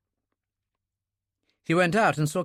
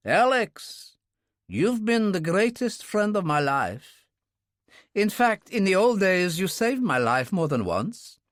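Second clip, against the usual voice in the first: lightly raised, earnest friend's voice; Russian man voice; 7 semitones higher than usual. A narrator's reading. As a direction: voice him as a sincere old comrade. Bounine speaks, narrator voices him.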